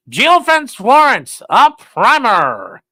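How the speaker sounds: put-on slow, loud old-timey newsreel announcer; 1940s crime narrator voice. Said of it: "nineteen forties crime voice".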